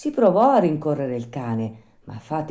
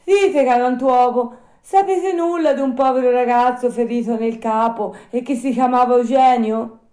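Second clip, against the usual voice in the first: high, loud little puppet voice; eager high-pitched questioning; inquiring puppet; 11 semitones higher, 8 dB louder than usual. Acted. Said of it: "domandò a un vecchietto".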